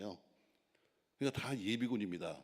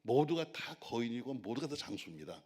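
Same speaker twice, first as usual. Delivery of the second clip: ordinary talk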